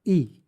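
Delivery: ई said alone, clear and loud; ई as a plain long vowel; Spanish y. Shown as a demonstration. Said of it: "इसका pronunciation होता है". (अकेले "ई" का साफ़ उच्चारण)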